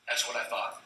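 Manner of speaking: a satisfied king under his breath; smug mutter. Acted. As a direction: mutter it like you expected it. Smug sheik aside.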